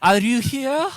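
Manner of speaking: high, loud hammed-up German officer; mock German soldier. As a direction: bark it like a joking stormtrooper. Mock Nazi soldier role-play.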